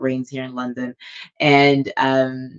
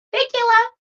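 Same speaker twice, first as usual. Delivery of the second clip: very high, louder sing-out of thanks; high-pitched thanks; 6 dB louder than usual. Real, not acted. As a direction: chirp a quick thank-you up high. Brief thanks to someone aside.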